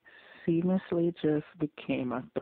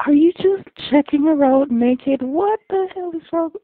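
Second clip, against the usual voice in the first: loud, high cry of disbelief; outraged disbelief; 10 semitones higher, 12 dB louder than usual. Real, not acted. Viewer yelling at characters in a show, outraged. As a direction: shout it at the screen, scandalized.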